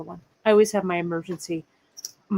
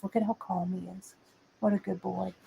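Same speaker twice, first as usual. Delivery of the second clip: soft pet-talk to a cat; cooing softly; 10 dB quieter, faster than usual. Real, not acted. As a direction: coo it gently, like to a pet.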